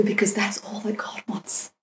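breathy whispered aside. Retold or imitated. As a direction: whisper it like a secret truth. Mentor repeats the line twice.

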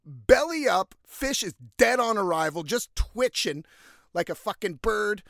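normal talking voice

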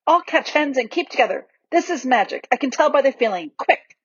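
understated storybook shouting; shouted. A narrator's reading. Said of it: "shouted Edmund".

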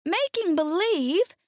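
put-on high, rising girlish question; crying out incredulously. A narrator's reading. Dorothy hearing the confession, shocked high question. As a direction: echo it high in disbelief. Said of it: "cried Dorothy".

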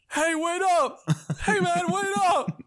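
high, drawn-out shout after a train; calling out. Acted. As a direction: yell for them to wait. Character calls out, chasing the train.

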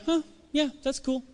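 a high, soft, indifferent huh yeah that's cool; unimpressed. Retold or imitated. Friend shrugging off your big news, indifferent. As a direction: brush it off like it's nothing.

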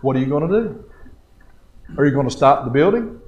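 normal talking voice

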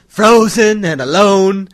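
loud, slow, high bellow; villain roar. Acted. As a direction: roar it out slowly. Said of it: "that hurts my throat".

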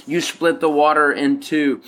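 ordinary delivery

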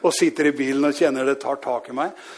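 normal talking voice

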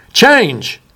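sudden high yelp of a word; startling boo. Shown as a demonstration. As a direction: jump out and startle them. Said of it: "Did I scare you?".

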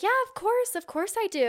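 jumps way up high, overeager "of course"; squeaky, high fake-innocent insistence; mock eager reassurance. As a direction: play the guy faking that he remembers. Guy pretending he remembers.

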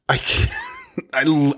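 slow words breaking into a laugh; laughing in disbelief. Real, not acted. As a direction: laugh at how absurd it is. Laughs at boss's boat remark.

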